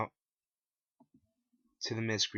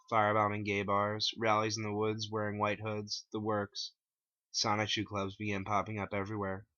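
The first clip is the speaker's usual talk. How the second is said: plain reading voice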